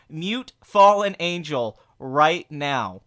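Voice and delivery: slow, emphatic order; serious command. Pretend. Streamer ordering a moderator to mute Fallen Angel, slow emphatic demand. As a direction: space out every word with force. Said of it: "I'm serious".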